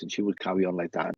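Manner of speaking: normal talking voice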